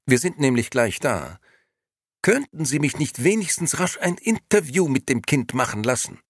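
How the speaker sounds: high-pitched pleading request; high, urgent lady's voice; insistent lady. A narrator's reading. Second lady presses for an interview.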